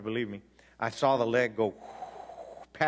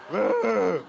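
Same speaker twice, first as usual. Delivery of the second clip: laughing wail; 6 semitones higher, 6 dB louder than usual. Real, not acted. Laughing wail after crying story.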